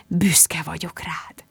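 slow, unvoiced whisper. Mum holding her daughter, whispering her pride. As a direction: hush it close to her ear, slowly. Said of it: "súgta".

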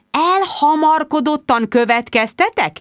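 slow, high, incredulous echo; indignant challenge. A narrator's reading. Bridget confronting Mark on the phone, indignant. Indignantly throws his accusation back.

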